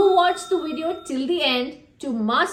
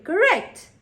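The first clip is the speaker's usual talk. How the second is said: ordinary talk, nothing marked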